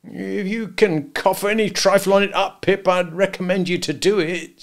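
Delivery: aghast. A narrator's reading. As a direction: urge the boy, thoroughly aghast. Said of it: "all aghast".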